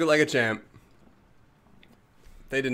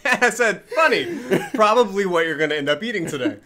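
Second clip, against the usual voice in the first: loud, high, laughing delivery; cracking up while telling it; 7 semitones higher, 8 dB louder than usual. Real, not acted. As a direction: talk through real laughter, voice raised. Laughing while retelling the coincidence.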